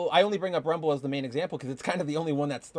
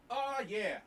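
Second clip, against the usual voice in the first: calling out from far off; yelling across the room; 6 semitones higher, 14 dB quieter than usual. Real, not acted. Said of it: "I can shout across the room".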